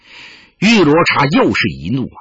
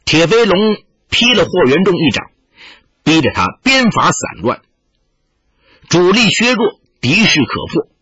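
plain reading voice

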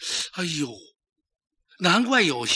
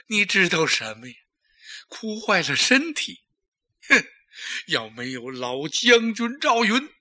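slow, tearful retort with a raised cry; tearful rebuke; 3 semitones higher than usual. Acted. Emperor weeping, scolding the courtier who tries to soothe him, tearful rebuke. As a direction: push him away, rebuke him through tears.

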